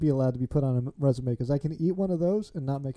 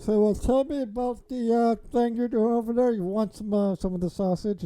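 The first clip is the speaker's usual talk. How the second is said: mock talk-show host; 7 semitones higher than usual. Mock host interview while eating.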